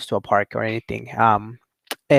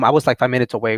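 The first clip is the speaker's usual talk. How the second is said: ordinary delivery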